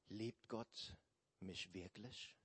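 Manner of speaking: soft, hushed self-doubt; quiet doubting. Acted. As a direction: ask it softly to yourself. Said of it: "begann sich Eva zu fragen".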